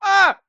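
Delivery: high, loud mock scream; mock panicked yell. Student yelling inside his head at the teacher, mock panic. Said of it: "but I was like".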